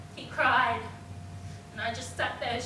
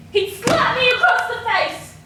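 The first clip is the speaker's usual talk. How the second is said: loud, higher cry of outrage; anguished outburst; 7 semitones higher, 9 dB louder than usual. Acted. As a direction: burst out as the slap is relived. Reliving a slap.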